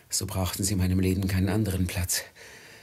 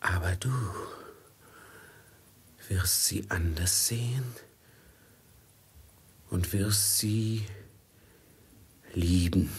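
whisper. Acted. Master whispering a prophecy to Velid. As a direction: lean in and breathe it. Feverish vision.

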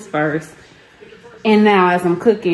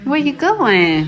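high, slow singsong call; 7 semitones higher, 4 dB louder, slower than usual. Real, not acted. Mom to baby who's off, high singsong calling. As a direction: call after a little one, pitched up.

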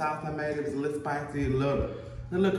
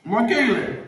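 loud call across the house; 7 semitones higher, 8 dB louder than usual. Real, not acted. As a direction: shout the name to another room. Calling someone in another room.

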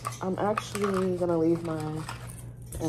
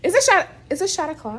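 high, fast and louder; excited; 10 semitones higher, 9 dB louder, faster than usual. Real, not acted. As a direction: hype it up. Excited about shot time.

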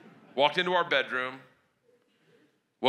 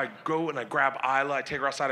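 ordinary talk

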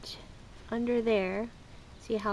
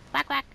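mock quack; 5 semitones higher, 3 dB louder than usual. Quacking at the ducks.

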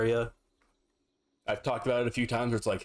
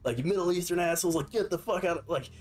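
higher, mocking rant of an indignant thief; 6 semitones higher than usual. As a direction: sneer it out as the outraged shoplifter. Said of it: "Just like".

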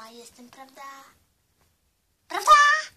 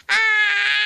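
shrill shout. Pretend. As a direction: belt out a throat-hurting ach. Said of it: "Aż mi gardło zaczęło boleć".

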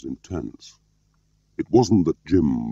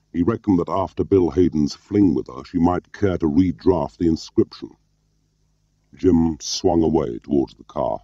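ordinary delivery

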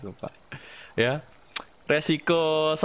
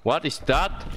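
normal talking voice

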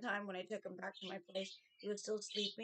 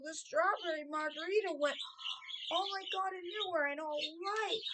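flustered panic; 7 semitones higher than usual. Retold or imitated. Reliving the spill.

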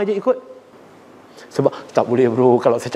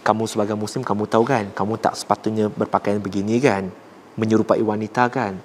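gentle correction; 6 semitones lower, 3 dB quieter than usual. Shown as a demonstration. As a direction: lower and softer, calm advice. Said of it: "suara saya okey tak?".

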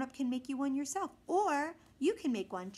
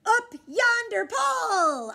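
much higher, loud slow shout; 11 semitones higher, 11 dB louder, slower than usual. A narrator's reading. Boy shouting goodbye to the pirates. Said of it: "I shouted".

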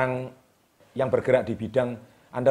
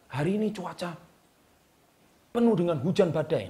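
higher-pitched, heightened weather forecast; dramatic intonation; 4 semitones higher than usual. Shown as a demonstration. Coach demonstrating a weather forecast to viewers, dramatic intonation. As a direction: make the storm sound like a big commotion. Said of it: "intonasinya itu menggambarkan hujan badai itu betapa hebohnya".

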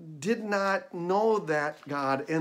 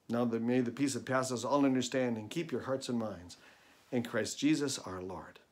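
benediction; 5 semitones lower, 7 dB quieter than usual. Acted. Closing benediction.

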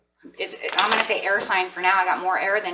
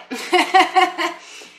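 loud, high burst of laughter; high-pitched real laugh; 12 semitones higher, 6 dB louder than usual. Real, not acted. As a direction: crack up at your own joke. Laughs at own strutting joke.

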